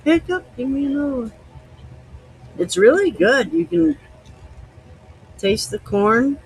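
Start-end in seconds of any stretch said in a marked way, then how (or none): 0.0-1.3 high squeaky voice asking for another shot; high squeaky pretend hiccup voice. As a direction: give the hiccups a needy little voice. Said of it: "It's like".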